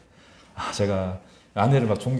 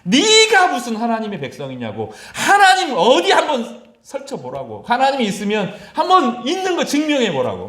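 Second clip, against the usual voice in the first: much higher, louder scornful jeer with laughter; taunting enemy; 10 semitones higher, 6 dB louder than usual. Retold or imitated. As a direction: dare God to show himself. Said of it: "랍사게가 막 조롱합니다". (랍사게가 하나님 백성을 비웃으며 높고 크게 조롱)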